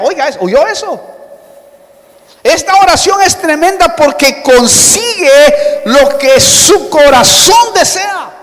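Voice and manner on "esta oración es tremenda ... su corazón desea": raised, high preacher's proclamation; emphatic repeat. Shown as a demonstration. Preacher repeats the promise to the congregation, emphatic and raised. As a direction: drive it home, pitched high and forceful.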